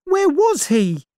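high, startled cry; young woman crying out in fright; frightened cry. Acted. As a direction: blurt it out in alarm. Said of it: "cried Vera".

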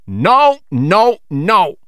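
high, loud protesting no; captain flatly refusing to sell; loud refusal. Acted. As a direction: shout the refusal, indignant.

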